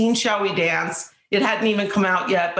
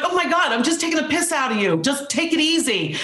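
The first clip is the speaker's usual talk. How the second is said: mock-reassuring Tom Cruise; 7 semitones higher than usual. Tom Cruise voiced in story.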